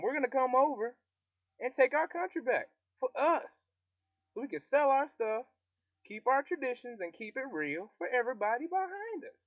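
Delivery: high, loud rebel rallying cry; rallying country folk. Retold or imitated. Country rebels rallying each other, high and loud. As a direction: pump up the crowd to take the country back.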